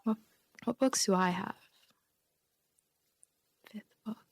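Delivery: ordinary talk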